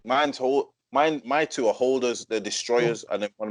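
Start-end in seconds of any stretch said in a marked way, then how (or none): none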